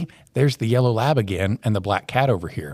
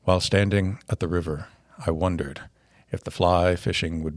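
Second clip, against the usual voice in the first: put-on low, quiet, solemn old author; Norman McLean voice; 6 semitones lower, 9 dB quieter than usual. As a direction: read it gravely, fading to a whisper. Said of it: "as we think Norman McLean would sound".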